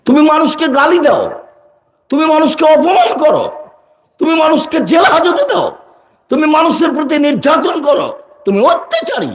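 very high, strained preacher's denunciation; heated accusation. Acted. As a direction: hurl each charge in the same soaring pitch. Preacher hurls accusations at listener. (চড়া গলায় ক্ষুব্ধ অভিযোগ)

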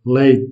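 "lait" said as LEH-teh with a light final E; Portuguese pronunciation. Shown as a demonstration. Teacher to learners, "lait" with a faint final E. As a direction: sound the T in "lait" and add a faint E. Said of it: "pour le mot lait".

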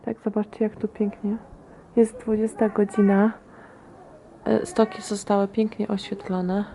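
quiet at night. Real, not acted. Evening, children asleep, hushed vlog.

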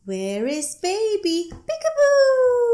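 high, loud playful peekaboo squeal. Pretend. Parent playing peekaboo with baby, loud and high. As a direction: burst out with a big peekaboo.

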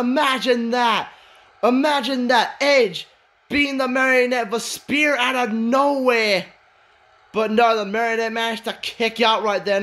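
high-energy match commentator; excited play-by-play. Acted. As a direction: call the shock comeback with rising disbelief. Live play-by-play, big upset.

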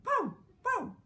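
high, loud barking pow pow; dog's barks. Acted. The dog barks after the fall, loud and high. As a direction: bark it out sharply, high. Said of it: "And the dog went".

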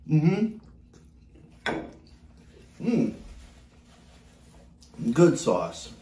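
ordinary talk

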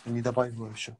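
ordinary talk, nothing marked

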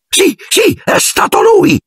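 very high frantic yell; frantic high-pitched shout. Acted. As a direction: scream the accusation. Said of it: "continuava a gridare Filch".

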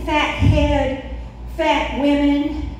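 normal talking voice

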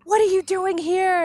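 high loud mock Beast roar; hammed-up angry Beast; Beast flipping out. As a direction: rage like the Beast finding Belle. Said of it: "he flips out".

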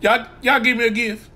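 ordinary delivery

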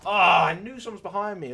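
loud, high-pitched complaint; frustrated yell. Real, not acted. Player killed from behind, loud frustrated protest. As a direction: cry out "oh", then protest loudly.

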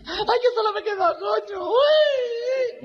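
very high, drawn-out crying shrieks; hammed-up wailing. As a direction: bawl theatrically in a camp falsetto. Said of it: "la mariquita llorando".

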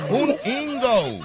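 drawn-out laughing fake English; mock English. Shown as a demonstration. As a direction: say "ingo" like English. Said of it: "tienes que decir un ingo".

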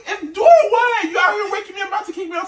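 angry shout. Real, not acted. Angry boyfriend yelling in quarrel.

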